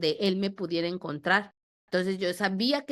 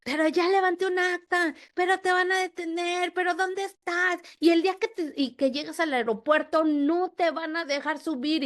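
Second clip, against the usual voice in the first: much higher mock threatening voice; mocking the ex's bullying calls; imitating the threatening ex; 9 semitones higher than usual. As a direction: parody his phone threats, pitched way up. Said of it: "todo el terrorismo en el teléfono de".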